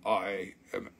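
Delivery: low, hushed reptilian villain; sinister lizard voice. Pretend. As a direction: creep the viewer out as the overlord. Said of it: "He's like".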